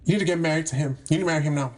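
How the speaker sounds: ordinary talk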